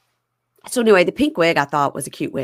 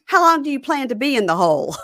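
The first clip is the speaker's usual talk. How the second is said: put-on high, incredulous girlfriend; high-pitched exasperated girlfriend; mock girlfriend; 7 semitones higher than usual. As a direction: demand it in a high voice. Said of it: "she goes".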